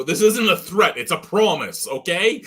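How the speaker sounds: hammed-up menacing promise; mock threat. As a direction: say it like a playful threat. Threatening quote to coworkers.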